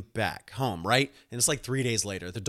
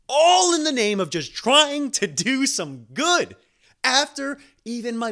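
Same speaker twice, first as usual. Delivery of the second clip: exasperated outburst; 12 semitones higher, 7 dB louder than usual. Real, not acted. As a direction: build the rant to an indignant peak. Exasperated climax of dog disaster.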